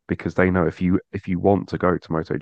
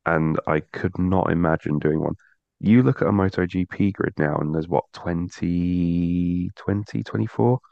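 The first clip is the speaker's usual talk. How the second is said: ordinary delivery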